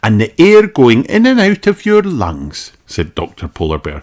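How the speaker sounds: high, friendly doctor; kindly doctor greeting a child; Doctor Polar Bear voice. Acted.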